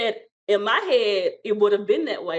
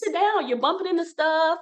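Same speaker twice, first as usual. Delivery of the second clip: worried husband; 7 semitones higher than usual. Retold or imitated. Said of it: "he said".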